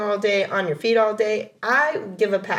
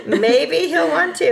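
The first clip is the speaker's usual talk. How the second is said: laughing; 4 semitones higher, 4 dB louder than usual. Real, not acted. Giggling fit over brother's voice.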